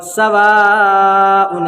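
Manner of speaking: सवाउन with the vowel stretched slowly; long madd. Shown as a demonstration. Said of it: "मद्दे मुत्तसिल हो जाएगा".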